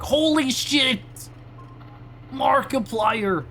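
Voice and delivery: hammed-up fan excitement; excited kids in audience. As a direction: imitate kids gasping at a celebrity cameo. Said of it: "all the kids in the audience go like".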